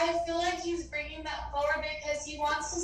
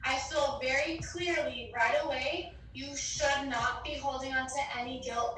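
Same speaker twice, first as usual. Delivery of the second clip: ordinary talk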